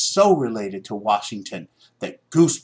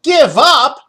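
much higher, much louder shout; outraged shout; 13 dB louder than usual. A narrator's reading. Boy shouts at his family in angry disbelief. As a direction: explode in disbelief. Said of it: "he shouted".

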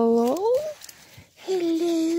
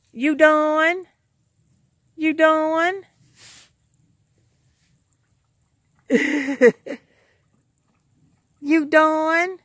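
higher, sing-song talk to a dog; 6 semitones higher than usual. Real, not acted. Coaxing her dog Jake to say bye-bye, sing-song baby talk.

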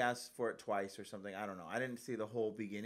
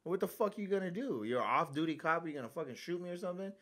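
angry challenge; 6 semitones higher than usual. Retold or imitated. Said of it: "I said".

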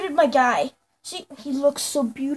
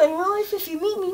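ordinary talk, nothing marked

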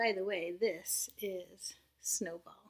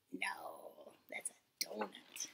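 soft whisper; whispered aside; 11 dB quieter, much slower than usual. Real, not acted. Teacher whispering aside to children about the donut. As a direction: let them in on the secret.

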